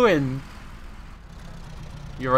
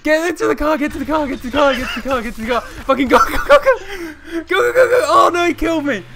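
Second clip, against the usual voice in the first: panicked high shouting, much louder; frantic yelling; 12 semitones higher, 7 dB louder, faster than usual. Real, not acted. Urging Jamie to flee to the car, frantic high shouts. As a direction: yell desperately at him to run. Fleeing armed man, urging friend.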